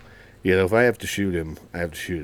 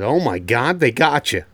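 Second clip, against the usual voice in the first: mock alarm; 5 semitones higher, 7 dB louder than usual. Mock alarm playing along.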